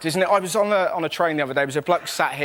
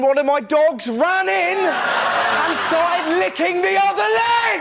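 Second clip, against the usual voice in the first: shouted, pitch climbing high; rising shout; 10 semitones higher, slower than usual. Real, not acted. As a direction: yell the punchline in mock horror. Punchline shouted in disbelief.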